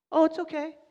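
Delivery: high, sing-song forgiving voice; sudden sweet tone from a once-angry driver; mock sweet driver. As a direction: play the softened driver, light and high.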